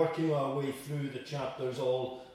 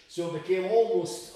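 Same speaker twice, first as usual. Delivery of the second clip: normal talking voice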